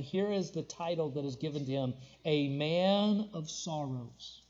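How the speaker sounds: ordinary delivery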